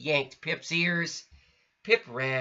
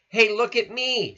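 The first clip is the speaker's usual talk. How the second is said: higher, louder gleeful squeal; 9 semitones higher, 6 dB louder than usual. Acted. Squeak showing off while sliding, higher louder squeal. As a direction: squeal with delight. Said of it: "Squeak squealed".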